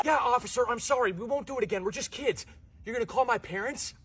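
nervous pleading. Acted. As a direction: plead with the cop like a caught kid. Caught kid pleads with officer.

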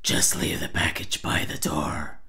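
low growly rattle. Acted. Telling a courier in the exercise voice, rattle. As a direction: give a creepy low rasped instruction.

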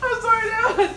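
high breathless laugh. Real, not acted. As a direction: crack up mid-apology. Laughing after slap.

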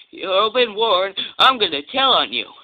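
tattling threat. Acted. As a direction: warn him you'll tell, loudly. Twin threatens Diesel in quarrel.

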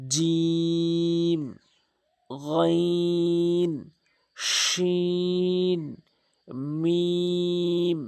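each letter name held very long; slow chanted letters; long drawn-out letters. Shown as a demonstration. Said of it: "তিন আলিফ টান হবে".

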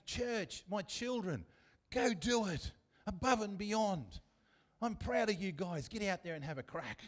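high, rousing sideline shout; cheering on. Acted. God cheering his church from the sidelines, rousing high shout. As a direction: cheer them on like a proud father. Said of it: "like God cheers us on".

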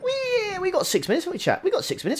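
playful high-pitched teasing for chat; squeaky silly voice. Pretend. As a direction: squeak it out like a cartoon.

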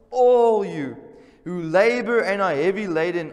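loud, slow preacher's call to come and rest; proclaimed invitation. Acted. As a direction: call out the verse to the congregation slowly. Preacher proclaiming Matthew verse.

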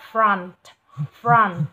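Front with f and r blended into one run, said loud; blended fr sound. Shown as a demonstration. Teacher modelling Front for the student, loud and blended. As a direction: glide the f into the r in Front. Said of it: "trộn vào nhau và đọc liền một mạch".

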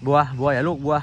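loud scolding call. Real, not acted. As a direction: stop the dog from afar. Calling the dog to stop.

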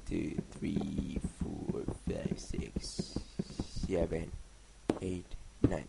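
counting blocks in a soft whisper. Real, not acted. Counting blocks under breath.

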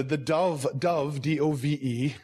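DOVE repeated slowly and distinctly, then spelled out; clarifying pronunciation. Real, not acted. Preacher apologising for his accent to the congregation, clarifying pronunciation. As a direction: say DOVE carefully, letter by letter. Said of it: "my accent, sorry".

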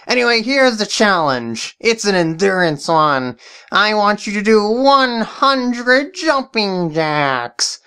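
high, sly villain voice; evil voice guy. Acted. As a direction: scheme like a cartoon baddie. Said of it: "why did you call me evil voice guy".

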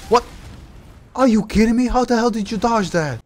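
high, loud incredulous cry; can't believe what you just saw; outraged disbelief. Real, not acted. Gamer shocked an enemy dodged his hit, high incredulous cry.

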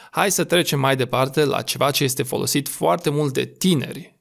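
usual speaking voice

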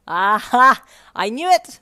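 loud, high gotcha cry; triumphant scream. Acted. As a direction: shout it in triumph. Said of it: "May screamed when she saw".

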